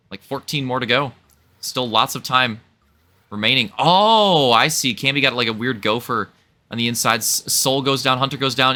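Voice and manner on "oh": loud startled oh; surprised oh. Real, not acted. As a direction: react to something sudden. Spots a crash.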